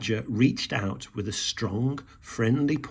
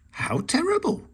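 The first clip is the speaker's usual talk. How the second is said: fretting. A narrator's reading. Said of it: "fretted Rabbit".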